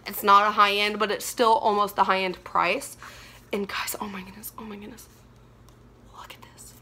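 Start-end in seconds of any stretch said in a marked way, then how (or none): 3.5-6.7 hushed, barely audible amazement; awed whisper. Real, not acted. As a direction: whisper it as if overwhelmed by what you see. Awestruck at the new palette.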